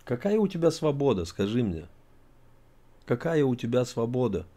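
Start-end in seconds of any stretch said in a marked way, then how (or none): none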